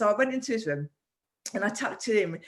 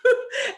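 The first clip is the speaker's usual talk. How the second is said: laugh; 6 dB louder than usual. Real, not acted. Laughs at son's preteen moment.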